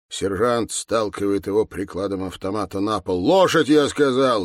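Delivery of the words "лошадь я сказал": loud, higher-pitched command; barked order. Acted. Sergeant forcing a man to the floor, barking. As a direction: order him down, loud and raised.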